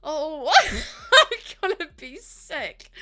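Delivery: loud, slow, high laugh-cry; laughing through tears. Real, not acted. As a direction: laugh it out overwhelmed. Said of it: "look through my tears".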